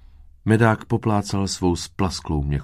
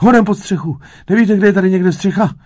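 very high, loud, hurried cry; panicked cricket; 11 dB louder, faster than usual. A narrator's reading. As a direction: cry out frantically for shelter. Panicked cricket seeks shelter.

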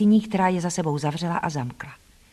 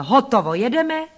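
louder call climbing high at the end; raised call, lifting sharply on the last word; 5 semitones higher, 4 dB louder than usual. Acted. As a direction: sing out the signal to go. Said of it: "někdo zvolal".

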